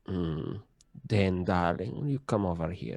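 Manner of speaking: a hint of a sultry priestess; lightly voiced seductive priestess. Shown as a demonstration. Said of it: "the voice of a, a, like, a priestess of Calistria".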